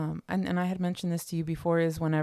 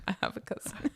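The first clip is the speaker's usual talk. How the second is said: breathy laughing aside, dropped almost to a whisper; 6 dB quieter, faster than usual. Real, not acted. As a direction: giggle it out softly, a bit coy. Laughing coy repeat of line.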